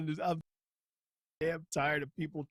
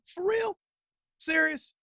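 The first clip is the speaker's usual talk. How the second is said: high, disbelieving squeak; mock shock, pitched way up; sarcastic high-pitched disbelief; incredulous; slower than usual. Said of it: "I'm like".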